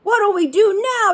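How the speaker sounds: high, frantic pirate cry; panicked pirate yell. Acted. Pirate shouting to the crew in the storm, panicked yell. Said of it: "yelled one of the pirates".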